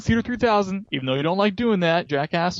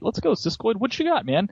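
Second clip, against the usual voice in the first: ordinary talk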